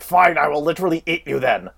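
lightly voiced monster threat; villain voice. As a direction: sneer it like a cartoon villain. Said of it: "then Fury's like".

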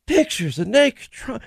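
high, slow mocking echo; Adam Schiff impression. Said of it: "That's Adam Schiff".

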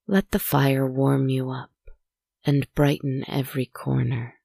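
plain reading voice

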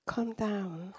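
whispered, soothing calm down; hushed calm down. Retold or imitated. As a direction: whisper it gently to calm her. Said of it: "He said to me".

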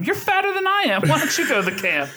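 high-pitched mock comeback; throwing it back. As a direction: toss the insult right back, voice leaping up. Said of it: "he throws it back at him".

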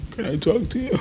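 mock him asking sheepishly; a pathetic high squeak of a request; meek mock apology. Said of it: "he's like".

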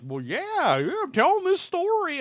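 mock casual witness. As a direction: lightly ham up a breezy storyteller. Mocking a casual alien witness.

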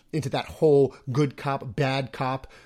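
ordinary delivery

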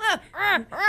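put-on high baby fussing sounds. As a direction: fuss like a baby. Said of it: "he's like".

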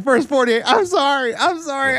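mock crying. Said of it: "It was freaking out".

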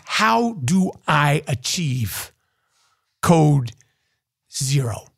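low and drawn out, pausing before each key word; deep, measured, deliberate delivery; slow, deliberate question. Real, not acted. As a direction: space it out for comic weight. Mock-earnest question, spaced out for effect.

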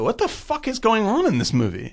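high incredulous exclaim; baffled. Retold or imitated. Podcast host mimics his baffled reaction, pitched high. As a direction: throw up your hands, high and baffled. Viewer's own retold reaction.

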